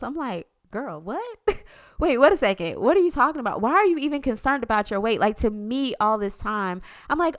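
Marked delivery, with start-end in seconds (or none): 0.7-5.2 louder, higher, fast disbelief; shocked disbelief. Retold or imitated. Mother to daughter worried about weight, shocked and fast. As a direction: blurt it out, shocked and baffled.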